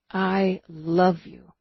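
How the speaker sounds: slow loving repeat; tender, slow. Acted. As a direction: linger on every word. Slower repeat.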